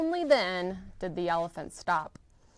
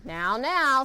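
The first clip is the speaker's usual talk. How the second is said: high, louder bossy little bug; 5 semitones higher, 5 dB louder than usual. Acted. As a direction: scold like a pushy bug. Bug scolds Horton.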